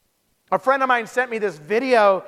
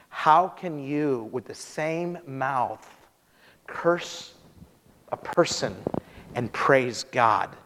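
low, slow and halting, with weighty pauses; hushed, deliberate reading in a low voice; solemn scripture reading; 9 semitones lower, 6 dB quieter, much slower than usual. Real, not acted. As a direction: read the verse gravely, letting each phrase hang. Reads out verse from James.

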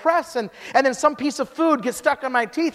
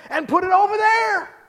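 playful raised voice; mock eager shout; 9 semitones higher than usual. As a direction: push the idea with comic gusto. Joking with the doctor.

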